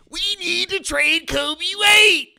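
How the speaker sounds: high, loud, drawn-out mock wail; whining fan. Bulls fan demanding a trade, mock whining. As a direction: whine it out like a pleading fan. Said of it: "Whining ass Bulls fans".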